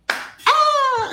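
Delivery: put-on very high little-kid squeal; imitated kid's cry. Eight-year-old kid jumping off the tower, high-pitched cry. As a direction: mimic a child squealing off the platform. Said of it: "This little eight-year-old kid".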